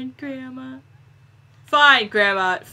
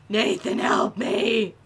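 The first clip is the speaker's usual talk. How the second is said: ordinary delivery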